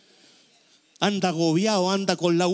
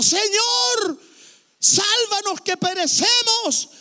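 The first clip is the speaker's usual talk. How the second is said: high panicked shout, then slow; panicked plea; 5 dB louder, much slower than usual. Acted. Preacher voicing terrified disciples waking Jesus, high panicked shout. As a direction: cry out to be saved from drowning.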